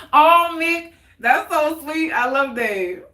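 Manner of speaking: high, loud aww; touched and delighted. Real, not acted. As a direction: gush over a sweet story. Touched by sweet story.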